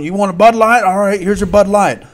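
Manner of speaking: loud, high, brash vendor's bark; a pushy stadium hawker serving a beer. Retold or imitated. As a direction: slam the beer down and announce it. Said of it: "he'd slam that thing down".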